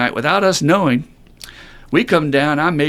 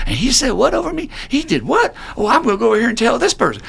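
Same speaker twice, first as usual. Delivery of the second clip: much higher, outraged mock gossip; mock reality-show gossip; 11 semitones higher, faster than usual. Mimicking a reality-show cast member, much higher, scandalized mock gossip. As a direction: ham up a scandalized reality star.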